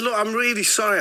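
high-pitched mock pleading; hammed-up frightened apology. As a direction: apologise as if begging for your life. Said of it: "I thought he was gonna kill me".

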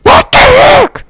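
high, slow, stretched-out shout. Real, not acted. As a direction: yell it out, pitch way up. Game fail outburst.

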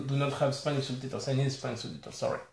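ordinary talk

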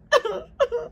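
a high laugh through tears; tearful laugh. Real, not acted. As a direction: laugh while crying. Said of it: "I don't know why I'm crying".

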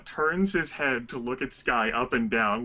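usual speaking voice

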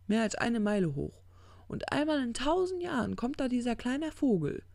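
worked-up parable teller; insistent demon. Acted. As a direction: press the point with lifted voice. Crowley lectures on eternity.

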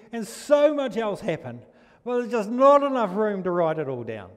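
preacher's soaring emphasis on the Gospel; fervent preaching. Acted. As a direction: lift the verse up to the congregation. Preaching with fervour.

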